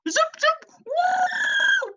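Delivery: tiny squeaky zipping gnome; extremely high-pitched little whoop; gnome noises. Acted. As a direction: dart past with very high cartoon squeals. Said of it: "I see the gnome".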